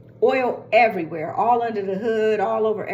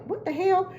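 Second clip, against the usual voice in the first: an incredulous what-the-hell; exasperated, high-pitched disbelief; shocked disbelief; 11 semitones higher than usual. Retold or imitated. Car won't accelerate on freeway.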